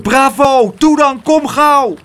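high, loud urging cheer; full-voiced shouts of encouragement; shouted. A narrator's reading. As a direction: yell them on urgently. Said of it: "schreeuwde Pluk".

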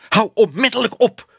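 loud, high-pitched command; shouted order. A narrator's reading. Exposed man orders the projector boy to stop, shouting. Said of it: "riep meneer Ellemeet".

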